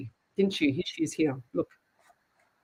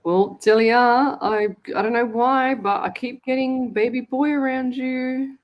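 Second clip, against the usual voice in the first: insistent; 5 semitones higher, 5 dB louder than usual. Real, not acted. Insisting on her reading.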